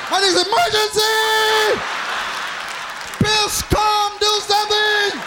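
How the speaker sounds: cartoon panic, yell for help; panicked dick voice. The dick shouting orders during the fire, high panicked yelling. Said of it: "my dick went to work".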